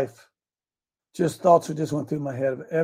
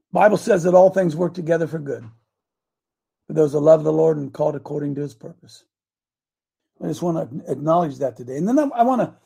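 ordinary talk, nothing marked

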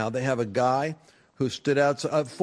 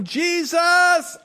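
higher, louder shouted Jesus; imitating her shout; 6 dB louder than usual. Host retelling the guest's joyful shout. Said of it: "She's shouting".

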